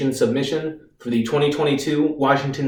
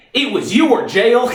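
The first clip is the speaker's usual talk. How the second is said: higher-pitched, mounting outrage; angry accusation; 5 semitones higher than usual. Acted. Reeves blames the Army lawyer for taking him, angry and accusing.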